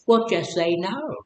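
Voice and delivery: a hint of a prim lady's voice, slow; mocking slogan. Quotes that lady's anti-drug slogan in a lightly mocking prim voice. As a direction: lightly mimic a preachy slogan. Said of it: "like that lady said".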